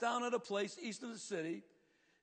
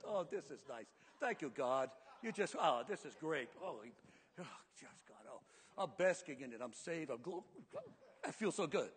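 contented basking; 5 semitones lower, 7 dB quieter than usual. Acted. Jonah enjoying the plant's shade.

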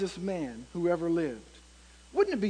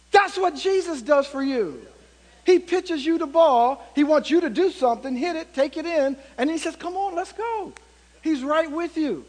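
high, driving preacher delivery; impassioned preaching; 7 semitones higher, 4 dB louder than usual. Acted. Preacher telling the congregation what Jesus does for them, impassioned preaching. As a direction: preach it out with raised pitch and force. Preacher drives home the lesson.

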